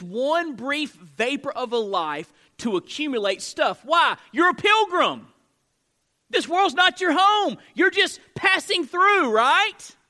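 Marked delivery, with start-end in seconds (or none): 3.8-10.0 full-force preaching. Real, not acted. Preaching climax, pilgrim.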